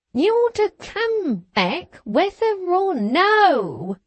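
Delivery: high, slow, emphatic screech. A narrator's reading. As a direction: lean hard on whether or no. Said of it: "repeated Winterbones with more emphasis".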